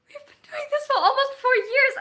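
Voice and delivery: high, loud, half-crying outburst; stunned realization. Real, not acted. Streamer realizing to chat how long it's been, loud, high, teary. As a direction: it suddenly hits her, voice cracking up high. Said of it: "that's the realization that just kicked in".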